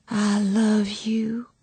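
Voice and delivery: cradle the words to the newborn; exhausted mother whispering to her baby; tender whisper. A narrator's reading. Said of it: "Ivy said to her".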